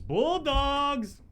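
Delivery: loud high team cheer. Real, not acted. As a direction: shout it like a fan.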